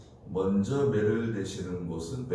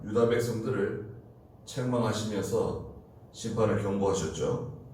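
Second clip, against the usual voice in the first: ordinary delivery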